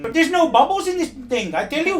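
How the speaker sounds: blustering high character voice. Acted. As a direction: boom it like a pompous old windbag.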